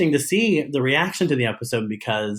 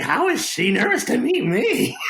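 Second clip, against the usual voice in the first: laughing disbelief; 11 semitones higher than usual. Retold or imitated. Laughing at Daisy being nervous.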